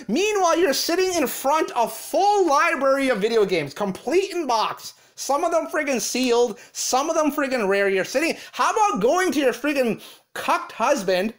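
usual speaking voice

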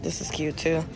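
ordinary talk

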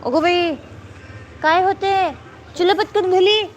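high, loud urgent mom voice. Real, not acted. Mother hurrying child indoors.

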